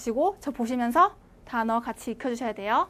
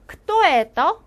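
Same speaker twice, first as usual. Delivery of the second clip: louder, higher, asking 크토에 에떠 with a rise; teacher's loud question; 4 semitones higher, 8 dB louder than usual. Shown as a demonstration. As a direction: ask the class 크토에 에떠 clearly and loud. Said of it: "제가 물어볼게요".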